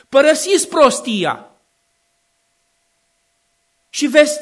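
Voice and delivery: loud preacher's cry of wisdom; wisdom calling out. Acted. Preacher voicing Wisdom calling to passers-by, loud call. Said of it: "strigă în gura mare".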